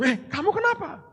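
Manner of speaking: high-pitched, puzzled question; bewildered wife. Retold or imitated. Confused wife questioning her husband, voice leaping up in bewilderment. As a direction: blurt it out high, baffled, imitating the wife. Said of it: "Istri saya bingung".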